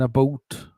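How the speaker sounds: "boat" standing in for "about", vowel rounded; imitating Canadian "about" as "a boat". Shown as a demonstration. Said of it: "they'll say".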